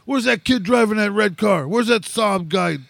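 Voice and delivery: high, angry demand at the door; angry dad yelling. Retold or imitated. Dad at the door, demanding the driver, angry. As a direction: storm the porch demanding the driver.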